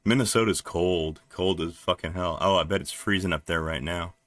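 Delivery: ordinary talk, nothing marked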